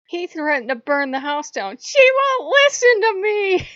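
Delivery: high, loud wail of complaint; hammed-up squabbling siblings, building to a yell; mock tattling. Retold squabble, tattling to Odin.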